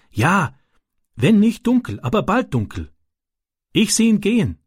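a hint of a young foreign boy telling his worry; Ram's childlike broken German. Ram answers Francis.

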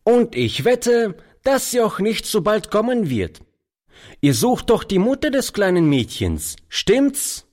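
put-on high-pitched boy calling over; light call, pitched high. A narrator's reading. Street boy asks the old man about the mother, calling out. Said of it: "rief der Junge dem alten Mann zu".

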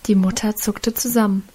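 ordinary delivery, nothing marked

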